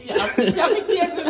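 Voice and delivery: laughing. Real, not acted. Laughing while urging a taste.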